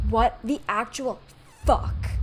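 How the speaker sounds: slow, loud disbelief; stunned outburst; shocked. Real, not acted. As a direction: drag it out in horror. Shocked reaction to game.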